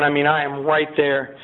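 ordinary delivery

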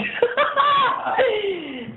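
high delighted laugh; squealing laugh of relief; high burst of laughter. Real, not acted. Laughing at the approval.

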